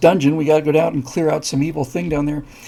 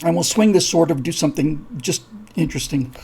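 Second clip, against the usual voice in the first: normal talking voice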